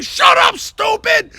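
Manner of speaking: loud, high mock shout; hammed-up high-pitched holler; imitated drunken yell. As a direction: cut in hollering like a belligerent drunk. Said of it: "he'd yell".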